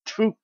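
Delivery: sharp high scornful spit; disgusted spit. A narrator's reading. Queen spits in disgust.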